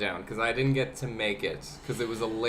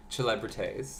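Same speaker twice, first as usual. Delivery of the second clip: Celebrities Italian-style, opening on "ch" and ending "-tays"; mock Italian pronunciation; 2 semitones lower, 5 dB quieter than usual. Shown as a demonstration. Host jokes about how Italians pronounce the venue, mock Italian accent on Celebrities. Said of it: "or as the Italians pronounce it".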